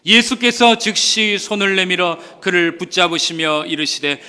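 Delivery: usual speaking voice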